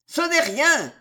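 high, loud cry of wild joy; joyful roar. A narrator's reading. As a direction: call it out from afar, overjoyed. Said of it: "rugit de loin Montmorency, dans une clameur de joie folle". (clameur de joie folle)

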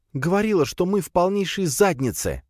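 high, exasperated woman's complaint; sighing reproach. A narrator's reading. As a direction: rub in the warning, pitched high. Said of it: "вздохнула Надя". (досадливый высокий женский упрёк)

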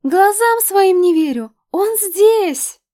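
high shrieking fangirl squeal. A narrator's reading. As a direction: squeal like a starstruck teen fan. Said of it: "пищали и визжали от восторга".

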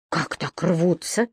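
surprised. A narrator's reading. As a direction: ask in quiet disbelief. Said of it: "удивился старший преподаватель".

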